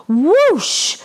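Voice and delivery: airy unvoiced whoosh of a slide; whispered swoosh effect. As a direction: breathe out the whoosh. Sliding sound effect.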